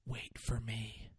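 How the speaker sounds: very soft, slow dying plea; faint slow last words; dying whisper. Retold or imitated. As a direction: breathe it out like a last word. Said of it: "He said".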